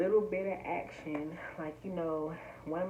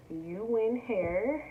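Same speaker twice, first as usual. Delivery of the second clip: slow, louder brand name; drawn-out reveal; 4 semitones higher, 5 dB louder, much slower than usual. Real, not acted. Vlogger revealing her sponsor's name, slow and louder. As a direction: announce You Win Hair slowly. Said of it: "announcing sponsor name".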